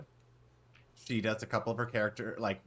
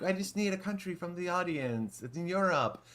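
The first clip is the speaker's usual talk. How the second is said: mock a vague European tour guide, pitched up; vague European accent; 8 semitones higher than usual. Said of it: "a vague European accent".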